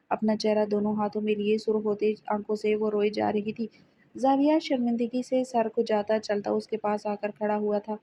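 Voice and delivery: ordinary delivery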